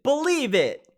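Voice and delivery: high, loud catchphrase yell; Naruto shout. Retold or imitated. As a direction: belt it out like an anime hero. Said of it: "Gotta love Naruto stuff".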